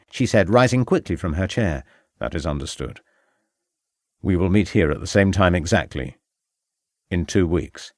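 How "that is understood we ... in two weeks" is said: low male voice. Acted. Male character in dialogue.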